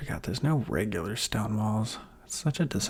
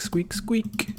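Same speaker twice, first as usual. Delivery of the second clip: "squeak, squeak" voiced in a very high mouse squeal; 12 semitones higher than usual. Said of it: "all I can hear is squeak".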